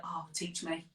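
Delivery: very soft, hushed mock plea. Writer imitating herself admiring great poets, softly pleading. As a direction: beg under your breath, half in jest. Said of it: "I'm just like".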